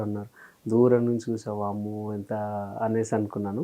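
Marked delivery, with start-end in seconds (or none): 1.5-2.3 much softer, breathy-free hushed wonder; quiet retold gasp of awe at a legend. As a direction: say it under your breath, amazed. Retold awe seeing a star.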